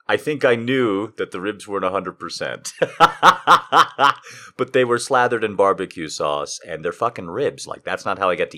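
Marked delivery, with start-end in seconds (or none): 2.8-4.5 loud burst of laughter at himself; big laugh. Real, not acted. Host laughing at his bad rib decision, loud laugh. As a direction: laugh out loud, high, at your own folly.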